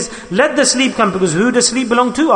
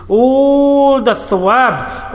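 preacher holds the word high; drawn-out emphasis; 6 semitones higher, much slower than usual. Acted. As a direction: stretch All the thawab out long. Preacher stretches phrase emphatically.

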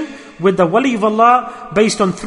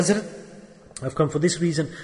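soft, lowered and humble; humble hush; 4 semitones lower, 9 dB quieter than usual. Acted. Disciple quietly addressing his shaykh, humble and soft. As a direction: speak meekly to a master.

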